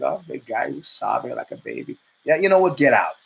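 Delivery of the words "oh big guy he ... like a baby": low, hushed gossip voice, half whispered; mock whisper. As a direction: whisper it like gossip behind his back. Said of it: "As when I was two sixty-five, he's".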